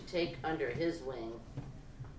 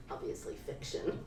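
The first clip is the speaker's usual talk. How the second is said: whispered aside; 8 dB quieter, faster than usual. Real, not acted. Aside breaking from the reading.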